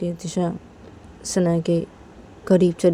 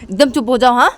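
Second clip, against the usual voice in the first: much higher, indignant, insistent scolding; angry scolding; 8 semitones higher than usual. Retold or imitated. Speaker scolding Tisha for hurting her sister, indignant. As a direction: shut her up, protective of the sister. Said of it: "तो मैंने बोलती".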